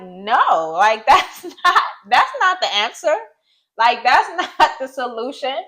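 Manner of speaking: high, incredulous objection with laughs breaking in; laughing protest. Retold or imitated. Daughter retells rejecting mother's answer, laughing protest. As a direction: push back, half laughing in disbelief.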